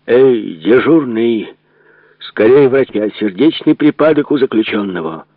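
костяной крик. Acted. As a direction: call out for the guard, loud and high. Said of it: "закричал костяным голосом". (кричит костяным голосом, зовёт на помощь)

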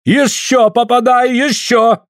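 high, drawn-out eager cry; shouted urging. A narrator's reading. Suль eggs Andrei on with an iron rod, shouting. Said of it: "кричит".

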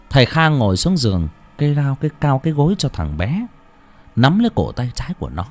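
ordinary delivery

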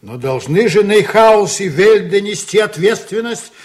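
angry outburst. Acted. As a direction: explode with indignation, swearing at the end. Captain erupts over shipowners' responsibility. (гневный выкрик, выше и громче)

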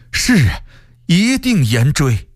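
high, drawn-out servile assent; deferential, high-pitched promise; obedient servant. A narrator's reading. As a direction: take the emperor's order eagerly. Servant obeys the emperor's order.

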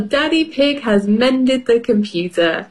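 ordinary delivery

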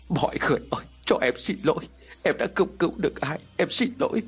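rising, breaking sobbing plea; sobbing apology. A narrator's reading. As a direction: sob out an apology to the dead. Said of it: "ôm mặt khóc nấc lên thành tiếng". (giọng khóc nấc xin lỗi)

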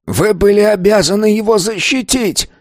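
high, forceful outcry; furious shout. A narrator's reading. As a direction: hurl the accusation at him. Old man accuses the man. (гневный крик)

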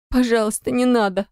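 high, pleading, on the verge of tears; tearful squeak. A narrator's reading. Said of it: "пищит она, громко всхлипывая".

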